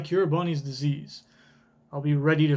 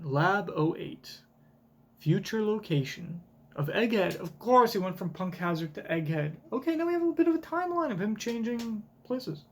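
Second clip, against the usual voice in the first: ordinary talk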